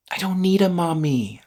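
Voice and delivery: ordinary talk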